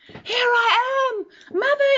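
put-on baby bird calling up to the plane, high, slow and crying out; high desperate call. Baby bird calls to the passing plane, high crying shout. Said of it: "he called out".

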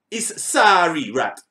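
says "It's sorry rat" at half speed, each word laid out; slow careful pronunciation. Shown as a demonstration. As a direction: spell out "sorry rat" slowly, as if teaching it. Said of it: "It don't matter how me say it".

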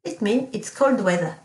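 ordinary talk

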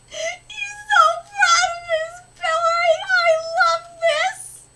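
high, loud crying gush; gushing squeal. Real, not acted. Fan gushing over a proud character, delighted.

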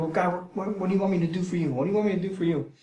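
a quiet, sincere prayer of service; humble offering. Retold or imitated. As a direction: offer yourself gently to God. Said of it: "with an attitude of".

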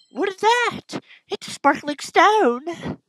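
put-on squeaky young girl reading her dialogue; girly game character. Voices game girl Lily.

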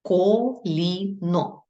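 Коліно said very slowly, syllables pulled apart; drawn out slowly. Shown as a demonstration. Teacher showing pupils the second syllable, very slow. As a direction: spell Коліно out by sound, slowly. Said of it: "Ми маємо підкреслити другий склад".

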